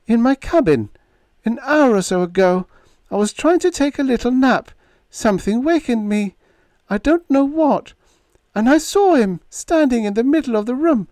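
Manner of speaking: put-on high, pleading young woman; lightly voiced pleading girl; young woman's voice. A narrator's reading. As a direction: insist through rising hope. Narrator voices Diane.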